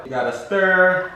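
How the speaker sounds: "stir" drawn out slow and loud; stress on stir. Pretend. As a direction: hold "stir" long and loud. Emphatic stirring instruction.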